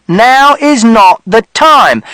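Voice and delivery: high, loud, slow firm shout; stern warning; Mister Shears shouting. A narrator's reading. Mister Shears shouting at father, stern. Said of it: "And Mister Shears shouted".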